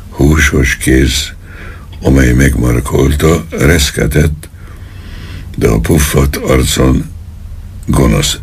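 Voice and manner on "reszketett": plain reading voice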